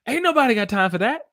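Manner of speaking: very high, loud and fast; exaggerated catchphrase. Teacher repeats a saying she loves, hammed-up high and fast. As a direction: squeal it out in one quick run.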